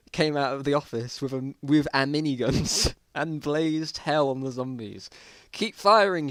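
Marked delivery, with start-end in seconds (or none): 5.5-6.3 sharp high shout of command; shouted order. A narrator's reading. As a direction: yell it over the gunfire. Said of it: "shouted the leader".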